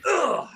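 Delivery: a sudden high pained grunt. Retold or imitated. Burglar hitting the floor, a high pained grunt. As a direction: yelp like you just landed flat on your back. Burglar slipping and landing on back.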